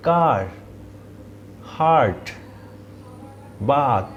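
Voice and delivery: কার, হার্ট, বাত with a forward long আ; front-mouth vowel. Shown as a demonstration. Teacher listing front-vowel examples. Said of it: "মুখের সামনের দিক থেকে উচ্চারিত হয়".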